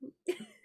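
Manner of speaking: squeaky laugh burst; a sudden high giggle; high-pitched laugh. Real, not acted.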